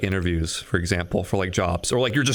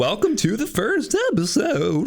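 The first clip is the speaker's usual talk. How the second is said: host voice; 6 semitones higher, 3 dB louder than usual. Retold or imitated. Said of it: "you do it on accident".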